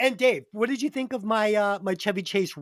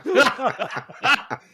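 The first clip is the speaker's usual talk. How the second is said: laughing. Real, not acted. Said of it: "laughing repeat".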